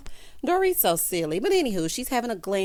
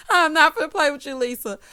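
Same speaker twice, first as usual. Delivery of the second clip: loud laughing through the words; loud cackling laughter; 7 semitones higher, 8 dB louder than usual. Real, not acted. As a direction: crack up while saying it. Laughing at her own joke.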